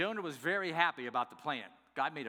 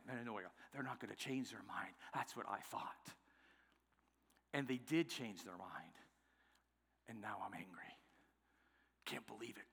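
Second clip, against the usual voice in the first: low, hushed inner monologue; brooding thoughts; 5 semitones lower, 8 dB quieter than usual. Acted. Jonah thinking alone on the hillside, in a hushed brooding. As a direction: brood under the breath, much softer and lower. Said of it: "That's exactly what he's thinking".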